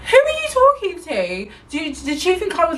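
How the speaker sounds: high-pitched, affronted challenge; indignant comeback. Retold or imitated. Customer challenging a rude shop worker, indignant. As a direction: throw it back, pitch shooting up.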